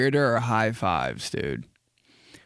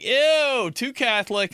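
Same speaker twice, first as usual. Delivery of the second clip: mock disgust; 11 semitones higher, slower than usual. Comic reacting to hot cross buns, high disgusted squeal. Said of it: "I'm like".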